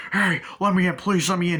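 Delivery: high, loud begging; acted urgent pleading. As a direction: beg the driver to let you in. Acting out pleading at car.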